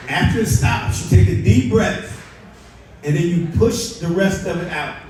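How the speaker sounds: ordinary talk, nothing marked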